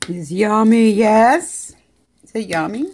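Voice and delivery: high, loud gushing coo. Real, not acted. Baby-talking to the dog eating.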